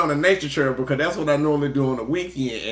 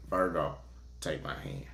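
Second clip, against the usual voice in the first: mock tender reading line; 10 semitones lower, 14 dB quieter than usual. Quoting a reading line, laughing.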